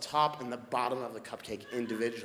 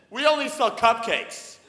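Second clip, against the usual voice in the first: mock clerk; 10 semitones higher, 12 dB louder than usual. Cupcake clerk quoted, loud.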